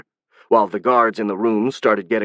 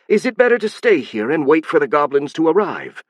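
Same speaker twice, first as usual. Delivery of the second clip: sarcastic; 6 semitones higher than usual. Acted. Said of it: "the Vex Queen asked sarcastically".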